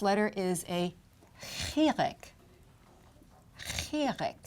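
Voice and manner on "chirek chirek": chirek said high and clear; vowel name demo. Shown as a demonstration. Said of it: "That has a short I sound".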